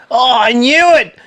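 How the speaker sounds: high, loud mock yell of triumph; thrilled recognition. Out-of-it stranger confirming his celebrity sighting, loud high excitement. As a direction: burst out loud and high, imitating an excited fan.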